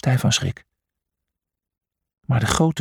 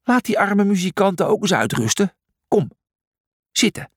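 higher-pitched call to settle them down; 7 semitones higher than usual. A narrator's reading. Julius telling the dancers to rest, calling out. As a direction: call them back to their seats, firmly. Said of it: "riep Julius".

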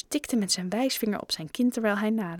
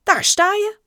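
high, loud, excited cry; triumphant exclamation; 13 semitones higher, 9 dB louder than usual. A narrator's reading. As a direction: cry out as if you have just found it. Raj triumphantly finds the photo. (triomfantelijke, luide uitroep)

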